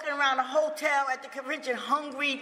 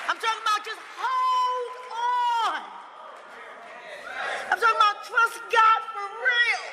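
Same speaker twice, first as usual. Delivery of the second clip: rising much higher into a shouted plea; fervent urging; 9 semitones higher than usual. Real, not acted. Urging struggling listeners to hold on, rising to a shout. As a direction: preach it up till you're shouting.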